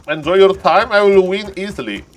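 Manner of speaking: high, loud, gloating boast; cocky taunt. Pretend. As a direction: taunt the opponent playfully.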